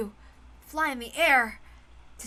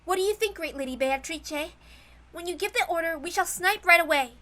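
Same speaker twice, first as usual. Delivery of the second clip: put-on soldier girl voice; 5 semitones higher, 5 dB louder than usual. Soldier girls ask Beatrice's orders.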